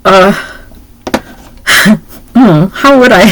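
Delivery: normal talking voice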